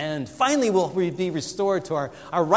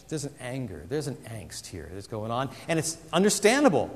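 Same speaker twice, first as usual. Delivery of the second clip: ordinary delivery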